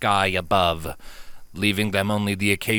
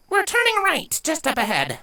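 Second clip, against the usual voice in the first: nervous filly. Acted. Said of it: "biting her lip as she did so".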